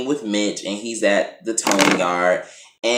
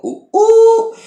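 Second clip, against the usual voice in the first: very high delighted ooh ooh, louder; excited squeal; 8 dB louder than usual. Real, not acted. Reacting to actor in tank top.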